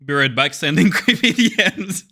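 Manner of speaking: laughing. Real, not acted. Laughs reading a redeem.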